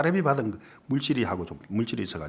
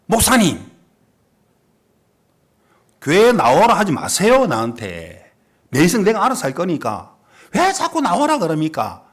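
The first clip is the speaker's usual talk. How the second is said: defiant retort; 4 semitones higher, 7 dB louder than usual. Retold or imitated. Defiant youth refuses the pastor.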